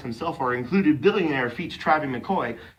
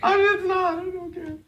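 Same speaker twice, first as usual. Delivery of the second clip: high, teary laugh-speech; words squeezed out through laughter; crying laughter. Real, not acted. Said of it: "Don't try to compose yourself".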